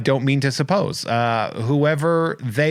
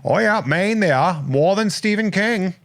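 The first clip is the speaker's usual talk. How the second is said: hammed-up Maine accent, pitched higher; 7 semitones higher than usual. Shown as a demonstration. Host showing off to his guest, higher Maine accent. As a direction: put on a high Maine accent. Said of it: "I can do that in a Maine accent".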